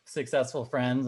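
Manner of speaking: normal talking voice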